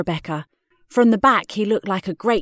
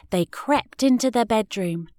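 ordinary delivery

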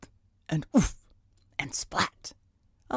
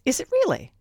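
surprised; 13 semitones higher, 8 dB louder, faster than usual. A narrator's reading. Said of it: "said Ben".

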